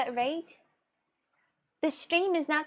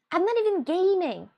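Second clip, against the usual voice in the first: higher, louder, exasperated; indignant; 6 semitones higher, 4 dB louder than usual. Real, not acted. To chat telling her to shut up, indignant. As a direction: protest that she isn't even gaming.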